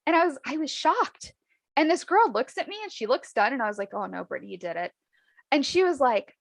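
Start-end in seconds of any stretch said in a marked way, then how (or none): 3.9-4.9 low, soft aside to herself; retold inner "oh no", dropped right down; dismayed self-talk. Retold dismay at her own blunder.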